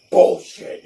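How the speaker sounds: loud, sharp mock-angry burst; angry dad outburst. Dad calling out his lying son, loud angry burst. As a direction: bark out the dad's accusation. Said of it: "And he was like".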